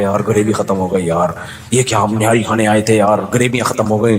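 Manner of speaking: low, dazed mock complaint; dazed diner. Overstuffed diners lying dazed, moaning in mock complaint. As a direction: groan it like someone half knocked out. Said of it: "थोड़ी बेहोश पड़े हुए हैं".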